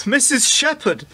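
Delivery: high startled echo of the name. Acted. Husband hearing his secret alias named aloud, startled. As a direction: caught out, voice jumps up.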